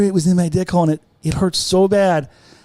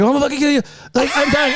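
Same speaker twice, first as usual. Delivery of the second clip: panicked cry; 8 semitones higher, faster than usual. Retold or imitated.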